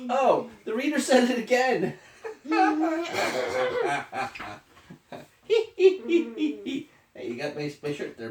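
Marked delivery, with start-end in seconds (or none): 0.1-6.9 high, laughing character line. Acted. Parent reading aloud, Elephant laughing at the reader. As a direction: giggle through it.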